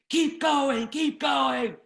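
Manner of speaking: high, loud, frantic command; imitated screaming. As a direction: act out a boss screaming at his driver. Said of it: "screaming and screaming at me to keep driving".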